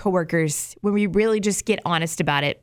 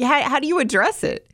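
ordinary delivery

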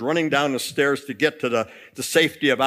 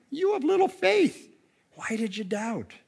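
acted mild rebuke; chiding Jesus; 8 semitones higher than usual. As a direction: gently reproach in a high voice. Acts Jesus gently chiding Peter.

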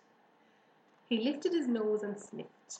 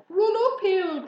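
high, loud sheep's call; crying out; 10 semitones higher, 11 dB louder than usual. A narrator's reading. Sheep advising the fleeing pig, urgent cry. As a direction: shout contrary advice urgently. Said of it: "cried the sheep".

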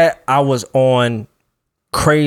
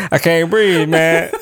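pitched-up breathless plea; mock breathless panic; 5 semitones higher, slower than usual. Shown as a demonstration. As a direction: act out struggling for air. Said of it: "Like that, right?".

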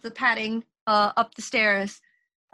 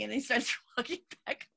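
ordinary talk

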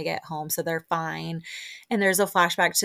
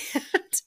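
squeaky laugh; 12 semitones higher than usual. Real, not acted. Reacting to naked chef.

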